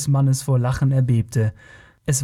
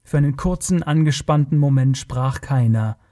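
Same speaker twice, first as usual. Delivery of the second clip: ordinary delivery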